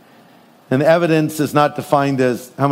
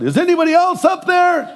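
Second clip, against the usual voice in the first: desperate yell; 13 semitones higher than usual. Retold or imitated. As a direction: shout upward, hoping for another rescuer. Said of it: "the guy yells out".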